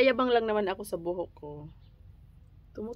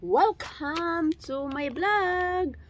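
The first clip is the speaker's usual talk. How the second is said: high, very slow drawn-out welcome; showy intro; 9 semitones higher, much slower than usual. Shown as a demonstration. Vlogger restarting her intro, grand drawn-out welcome. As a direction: announce it like a big show opener. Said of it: "So, baliktad natin".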